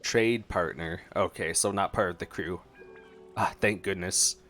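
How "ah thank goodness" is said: usual speaking voice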